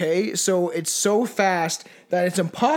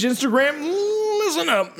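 high, drawn-out silly plug; slow, high-pitched mock announcer; hammed-up sign-off; 9 semitones higher, slower than usual. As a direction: stretch it out high and goofy.

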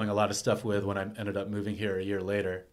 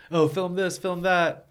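higher, louder mimicry of a pushy onlooker; mimicked pesterer; 9 semitones higher, 5 dB louder, slower than usual. Someone pestering him to film things, in a mock demanding voice. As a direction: put on a raised, demanding voice.

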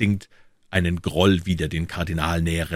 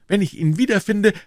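put-on high, impetuous young hero; defiant young D'Artagnan; 5 dB louder than usual. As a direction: defy the warning in a youth's raised voice. D'Artagnan defies the warning. (trotziger junger Mann, hohe Stimme)

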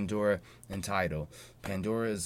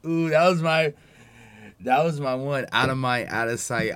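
loud, higher hype at his own line; excited shout; 5 semitones higher, 11 dB louder than usual. Real, not acted. Host hyped over his old rap line, loud excited shout. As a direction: shout out the favourite bar, pumped.

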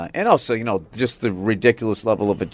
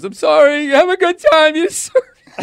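imitated tearful wife, squeaky high; mock-sobbing apology at a very high pitch; laughing through crying; 5 dB louder than usual. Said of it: "laughing through crying".

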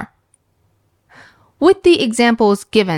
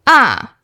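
Ah falling steeply from high; "Ah" starting high, plunging down; 3 semitones higher, 3 dB louder than usual. Shown as a demonstration. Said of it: "the falling tone".

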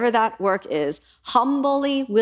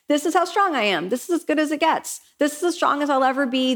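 pitched up, mock-smug "This is how strong I am"; a self-satisfied, pitched-up echo of "This is as good as it gets"; higher smug restating; 6 semitones higher than usual. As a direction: parrot your own smug belief, higher. Mocking her own limits.

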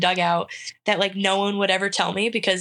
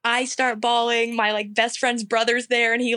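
normal talking voice

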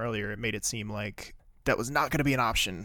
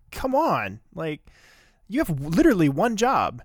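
high, loud exasperated outburst; fed-up yelling at the daycare lady; exasperated rant; 7 semitones higher, 9 dB louder than usual. Real, not acted. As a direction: let the frustration burst out. Ranting at careless daycare worker.

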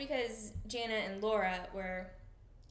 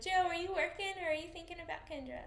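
higher-pitched, lightly mocking question; imitated teasing, pitched up; teasing quote; 8 semitones higher than usual. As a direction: needle them playfully in a raised voice.

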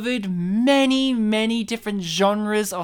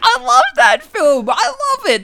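high, loud fan squeal; over-the-top gushing; 9 semitones higher, 7 dB louder than usual. Pretend. Co-host hears the film's name, over-the-top gushing. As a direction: gush wildly, voice shooting way up.

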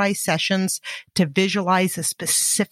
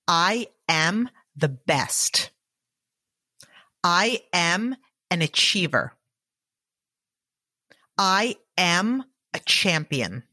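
guided affirmations. Acted. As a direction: state each affirmation steadily for repeating. Recited affirmations for listener to repeat.

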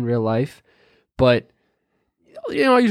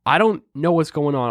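usual speaking voice

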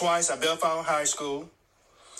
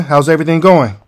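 ordinary talk, nothing marked

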